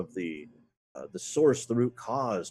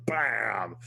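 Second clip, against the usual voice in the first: shouted bam. Acted. Mimicking blows in interrogation.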